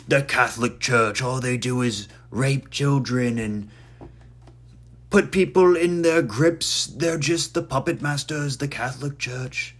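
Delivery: hammed-up accusing rant; mock ranting. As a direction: put on a voice railing at the Church. Mock rant against the Church.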